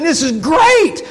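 high, loud, ecstatic shout; jumping for joy. Retold or imitated. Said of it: "jumping for joy".

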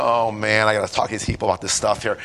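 low, fast inner grumble; muttered aside. Retold or imitated. Preacher grumbling to himself under his breath. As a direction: mutter it to yourself, quick and low.

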